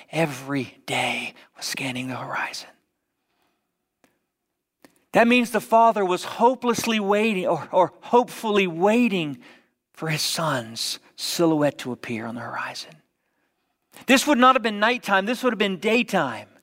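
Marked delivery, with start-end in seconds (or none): 0.1-2.7 hushed, breathy whisper on the horizon. Acted. Preacher drops to whisper. 9.9-12.9 soft whispered longing; hushed, picturing the horizon. Acted. Preacher whispers longing image.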